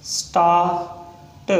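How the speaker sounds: Start split into "staa", pause, "t"; sounded out slowly. Shown as a demonstration. Teacher breaking down a shorthand example, sounded out slowly. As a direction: stretch start, detaching the final t.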